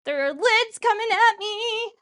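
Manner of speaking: high, loud cry of alarm; mild alarm. Real, not acted. Cook reacts to lids coming at her, loud and alarmed. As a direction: call out as things come at you.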